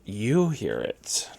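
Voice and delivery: usual speaking voice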